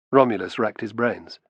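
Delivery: usual speaking voice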